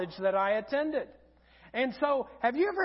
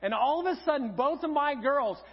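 usual speaking voice

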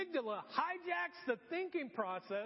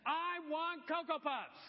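put-on higher, slow pleading child; small child begging mom for Cocoa Puffs; whiny toddler; 6 semitones higher, slower than usual. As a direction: drag it out like a three-year-old wanting cereal. Child demanding cereal.

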